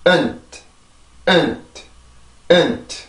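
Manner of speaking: weak short 은트 ending in a released t; light 은트, t just a puff; pronunciation drill. Shown as a demonstration. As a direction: model unstressed 은트 by itself. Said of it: "다시 한번".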